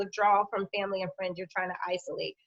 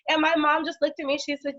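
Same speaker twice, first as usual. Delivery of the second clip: usual speaking voice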